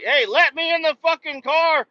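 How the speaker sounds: high, loud, rowdy demand; hammed-up rowdy yell; mock rowdy homeless guy. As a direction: holler to be let into the car. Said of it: "They're like".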